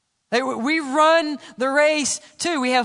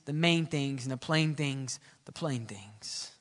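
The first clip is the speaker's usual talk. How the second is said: fading prayer repetition; 9 semitones lower, 10 dB quieter than usual. Acted. Prayer phrase repeated, fading away.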